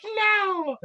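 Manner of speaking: put-on high squeaky old lady no; a shocked old woman shrieks no; old lady's no. Old lady refusing the choker, high squeal.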